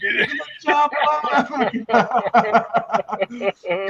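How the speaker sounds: very slow, laughing mock Arnold line; laughing quote. Quoting Arnold while cracking up. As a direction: drag it out through laughter.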